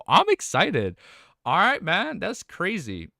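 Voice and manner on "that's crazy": normal talking voice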